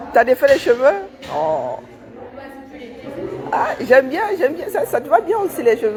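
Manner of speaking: high, loud delighted praise; delighted praise for a colleague's loosened hair; excited compliment. Real, not acted. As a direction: gush over the new hairdo.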